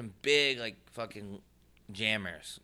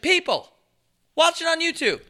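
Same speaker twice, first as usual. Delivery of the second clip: loud, much higher exasperated plea; exasperated shout; 8 dB louder than usual. Retold or imitated. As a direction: yell it in frustration. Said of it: "And I'm just like".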